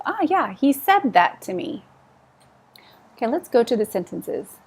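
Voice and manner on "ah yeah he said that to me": lightly acted reply, higher; role play answer. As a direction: answer as in a little dialogue. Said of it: "answering in the role play".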